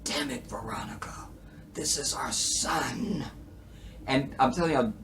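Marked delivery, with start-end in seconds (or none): 0.0-3.2 soft breathy whisper; David's whisper. Retold or imitated. Imitating David whispering to Stormcloud on the phone. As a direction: whisper it like a spent man. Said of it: "So he calls Stormcloud".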